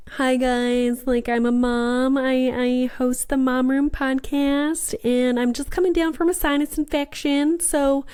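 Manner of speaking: lightly put-on Southern mom voice to the party crowd; Southern accent. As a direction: introduce yourself as a sweet Southern mom. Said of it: "turning into, like, a Southern person".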